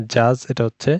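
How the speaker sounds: usual speaking voice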